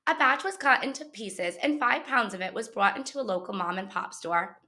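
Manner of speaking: plain reading voice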